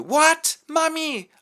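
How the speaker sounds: put-on high, loud anxious girl voice. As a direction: call out nervously for mum. Daughter cries for mother.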